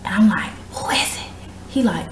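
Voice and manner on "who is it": hushed so the family won't wake; whispered. Retold or imitated. Woman whispering at the door while her mom sleeps, whisper.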